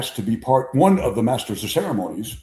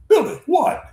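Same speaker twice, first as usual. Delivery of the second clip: put-on loud, high Oklahoma accent; mock exasperation in an Oklahoma twang; Mickey Mantle; 5 dB louder than usual. Mantle to a crying Billy, exasperated Oklahoma accent. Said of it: "Oklahoma accent".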